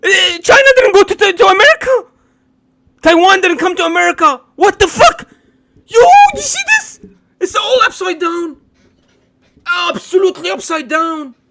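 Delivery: loud, high, incredulous outrage near tears; outraged yelling. Real, not acted. Streamer to viewers about the clip, loud outraged disbelief. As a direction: shout it in disbelief, voice cracking.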